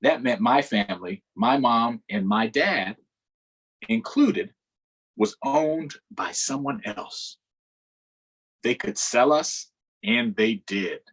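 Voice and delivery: steady read-aloud storytelling voice; story narration. A narrator's reading. Teacher reading a book aloud to children, narrating. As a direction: read the story to the class, measured.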